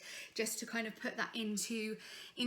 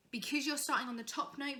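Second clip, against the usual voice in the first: ordinary talk, nothing marked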